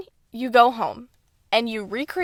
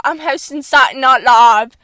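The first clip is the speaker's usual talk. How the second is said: mock British accent; 2 semitones higher, 8 dB louder than usual. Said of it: "And, like".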